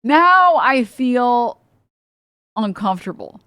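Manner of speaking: now I feel drawn out slowly; mock uncomfortable drawl; slow, drawn-out repeat. Shown as a demonstration. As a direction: stretch it out, uneasy.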